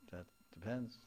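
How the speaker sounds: usual speaking voice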